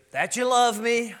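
put-on high, loud, slow and laughing, a cheeky little boy; giggly child voice asking back; child's reply. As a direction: play the delighted kid guessing the answer. Son's reply retold with laugh.

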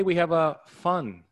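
"fun" punched high and loud, drawn out; stress on fun. Real, not acted. Host promising listeners a fun webinar, stress on "fun". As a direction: lean hard on "fun", slowly and loudly. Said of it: "I really will emphasize the word fun".

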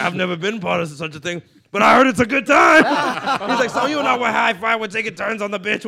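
loud, high, laughing Diaz bellow; comic bellowing Diaz's dark punchline to the table; mock shout laughing. Acted Diaz riff.

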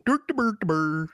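high, sing-song mock Swedish gibberish; Swedish Chef. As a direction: babble in a comic Swedish accent. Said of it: "it's just the Swedish Chef".

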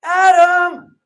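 high, loud calling for Adam; God calling out loudly for Adam. Pretend. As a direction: call out across the garden, searching.

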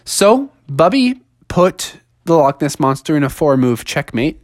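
plain reading voice

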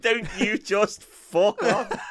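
slow, high-pitched mock indignation; hammed-up mock outburst. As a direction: draw each word out high, half laughing. Mockingly re-voices the quoted outburst.

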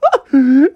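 loud, high cartoon bawling; mock overjoyed blubbering; happy crying. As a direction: sob hammed-up happy tears. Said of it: "started to cry happy tears".

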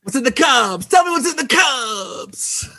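high, loud, slow anguished shout; Brad Pitt impression. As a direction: bellow it like the tormented detective, dragging it out. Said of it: "do it again".

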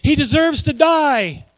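high, slow cry of condemnation; condemning cry. A narrator's reading. Council sentencing Jesus to death, high drawn-out cry. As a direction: pronounce it as an outraged crowd. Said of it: "they all cried".

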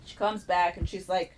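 ordinary talk, nothing marked

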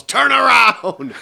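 loud, high, drawn-out shout imitating a cop; retold full-voiced command; yelled order. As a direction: bellow it like an irate sergeant. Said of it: "and just yells".

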